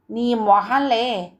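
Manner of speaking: slow, drawn-out dismissive scoff. Acted. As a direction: stretch it out, waving the idea away. Grandmother dismisses his claim. (నెమ్మదిగా సాగదీసిన ఎగతాళి)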